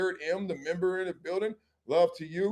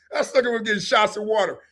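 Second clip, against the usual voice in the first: usual speaking voice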